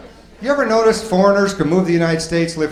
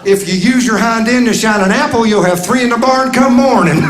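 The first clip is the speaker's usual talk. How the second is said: put-on higher, folksy old-man voice saying nonsense; mock old-timer wisdom; 7 semitones higher, 4 dB louder than usual. Old man messing with listeners, higher folksy voice. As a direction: play a rambling grandpa spouting nonsense.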